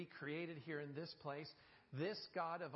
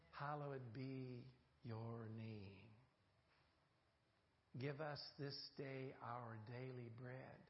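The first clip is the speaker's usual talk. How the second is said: softer, slower, reverent reading of the prayer; hushed prayer recitation; 6 semitones lower, 6 dB quieter, slower than usual. Acted. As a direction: drop into a quiet prayer voice.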